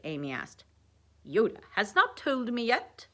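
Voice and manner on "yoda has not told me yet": higher, louder mock-British Sherlock; Sherlock Holmes accent. A narrator's reading. Dwight answering Amy's question, in Sherlock accent. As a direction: keep up the put-on Sherlock accent.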